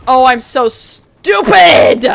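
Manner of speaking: loud self-scolding yell, last word stretched; frustrated shout. Real, not acted. Just died in the game.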